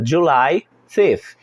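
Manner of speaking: "July fifth" drawn out very slowly, the th in "fifth" stressed; slow pronunciation model. Shown as a demonstration. Teacher writing on the board, saying it very slowly. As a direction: stretch "July fifth" for the class. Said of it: "E aí eu escrevo lá na lousa".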